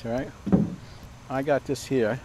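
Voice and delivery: ordinary talk, nothing marked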